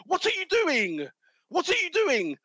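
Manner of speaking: high-pitched, strained imitation of a player yelling at the ref; mock outraged yell. Pundit acting out Saka shouting at the ref, mock fury. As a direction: play Saka losing it at the referee, half-acted. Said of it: "'cause Saka's looked at the referee for".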